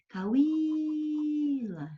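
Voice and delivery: cauíla with the í held long and high; stressed cauíla. Shown as a demonstration. Teacher showing students the stressed i, drawn out high. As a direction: sing out the í in cauíla. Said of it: "por exemplo".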